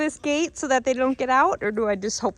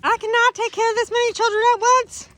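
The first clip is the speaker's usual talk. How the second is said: much higher and louder, exasperated; voicing the mother goat; 13 semitones higher, 8 dB louder than usual. Voicing Arian the mother goat, high, loud exasperated complaint. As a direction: play the overwhelmed goat mama.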